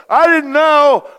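put-on very high, loud, drawn-out child's whine; whiny kid. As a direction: stretch the excuse like a guilty kid. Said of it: "you go".

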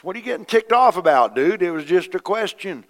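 loud, high mock comeback; retold retort. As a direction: toss it back at him, amused. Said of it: "I said".

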